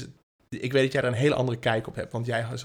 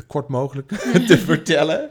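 laughing; 4 semitones higher, 10 dB louder than usual. Real, not acted. Laughing at own long story.

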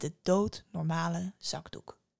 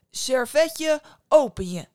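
louder, forceful magic words; loud command; 6 semitones higher, 10 dB louder, slower than usual. Acted. Mother loudly commands the napkin to open. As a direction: call it out with conviction.

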